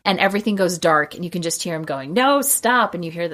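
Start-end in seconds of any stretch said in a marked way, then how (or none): 2.1-2.9 higher, louder, hammed-up protest; mock panicked plea. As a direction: play the villain suddenly scared. Villain doused with gasoline.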